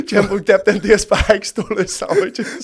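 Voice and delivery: loud, high-pitched, cracking up; laughing speech. Real, not acted. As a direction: push the punchline out between laughs. Laughing while retelling the theft.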